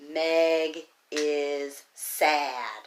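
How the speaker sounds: Meg is sad drawn out word by word; very slow reading. Shown as a demonstration. Teacher reading back the written sentence slowly. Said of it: "help me read that sentence".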